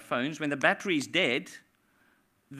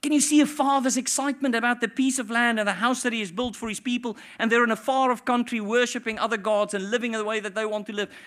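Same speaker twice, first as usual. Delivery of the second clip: ordinary talk, nothing marked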